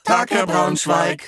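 low gruff greeting. Acted. Tenant greets landlord in kind.

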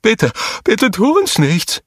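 terrified high-pitched begging; frightened scream. A narrator's reading. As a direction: beg for your life, squealing. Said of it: "schrie Nigor auf".

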